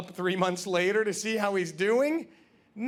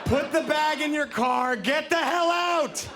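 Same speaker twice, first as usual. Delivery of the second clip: excited yelling; 8 semitones higher, slower than usual. Retold or imitated. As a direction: urge him out with a frantic laughing shout. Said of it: "And I'm like".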